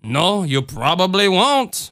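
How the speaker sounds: put-on slow, sad Cajun accent; Ma Marie. A narrator's reading. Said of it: "Ma Marie agreed sadly".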